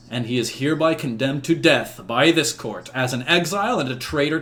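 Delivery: formal royal verdict, swelling at key declarations; proclaiming king. Acted. King absolves knights and condemns the enchanter, proclaiming. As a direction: pronounce judgment to the whole court.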